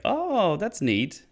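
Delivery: squeaky high sarcastic oh; fake-impressed, mocking it; mock surprise. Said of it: "It's like".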